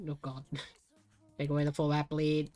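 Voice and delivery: ordinary talk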